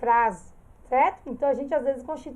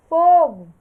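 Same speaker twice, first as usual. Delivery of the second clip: shouted alarm; 3 semitones higher, 10 dB louder than usual. Shown as a demonstration. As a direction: shout "Fogo" loudly, as if calling fire. Example word fire shouted.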